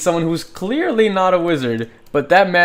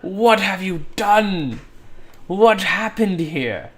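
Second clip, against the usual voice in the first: slow, high, aghast questions; the other guy confronts the murderer, horrified; shocked bystander; 6 semitones higher, slower than usual. Retold or imitated. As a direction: gasp it out at the bloody scene. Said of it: "the other guy asks them".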